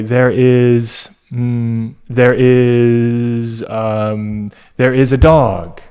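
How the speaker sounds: halting, drawn-out restarts with filler hums; hesitant, repeating. Shown as a demonstration. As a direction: stall and restart while thinking of the words. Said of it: "habit of repeating themselves as they are thinking".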